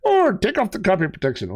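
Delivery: high, impish little leprechaun. Pretend. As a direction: play a cheeky leprechaun.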